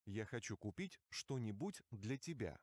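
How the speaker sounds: normal talking voice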